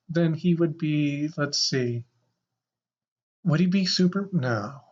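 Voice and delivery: ordinary talk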